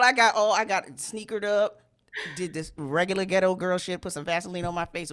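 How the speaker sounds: ordinary delivery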